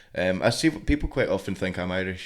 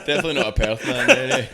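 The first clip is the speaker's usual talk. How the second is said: high, loud words through laughter; real laughter breaking into the words; laughing tease; 8 semitones higher, 6 dB louder than usual. Real, not acted. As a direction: laugh out the tease, pitch jumping up. Teasing while laughing along.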